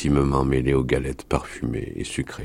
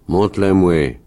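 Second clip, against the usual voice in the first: louder, higher character voice; insistent demand; 5 semitones higher, 5 dB louder than usual. Acted. Wolf leader asks to see the farmer's child, insistent. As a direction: demand it with raised voice.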